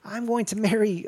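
lightly voiced old villain talking big; David Lopan. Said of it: "he's a very frail old man".

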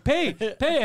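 high, insistent mock demand; imitated angry demand. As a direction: demand payment as the outraged buddy. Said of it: "he's demanding they take it".

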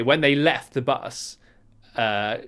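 normal talking voice

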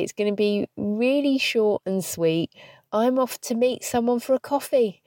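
ordinary talk

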